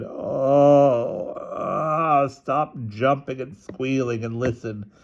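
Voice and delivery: sleepy father yawning as he speaks. A narrator's reading. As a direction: talk through a big drawn-out yawn. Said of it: "turned over and yawned".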